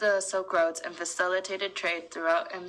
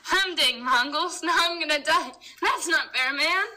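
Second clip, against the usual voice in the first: high, loud indignant whine; wild unhinged ranting; 8 semitones higher, 4 dB louder than usual. Acted. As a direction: rant like a wronged peasant. Victim complaining about Mongols.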